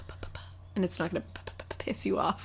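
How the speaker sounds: fast whispered p pops; popping p whisper. Shown as a demonstration. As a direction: pop every p into the mic. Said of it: "Your eyes got wicked wide when you did that".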